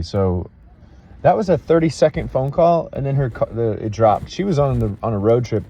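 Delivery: usual speaking voice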